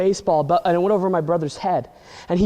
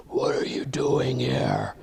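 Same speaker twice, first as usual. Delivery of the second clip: lower, slow sinister whisper; creepy demon dog whispering; evil whisper; 6 semitones lower, 6 dB quieter, much slower than usual. Acted. Evil dog Lucy confronts Ben.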